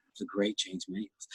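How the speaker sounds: very soft, low awed aside; hushed awe. Acted. Speaker imitating a fan awed by a famous judge, hushed. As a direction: drop right down to a reverent hush.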